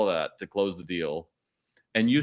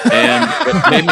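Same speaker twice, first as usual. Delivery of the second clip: laughing; 3 semitones higher, 13 dB louder than usual. Real, not acted. Laughing while recalling shoe kid.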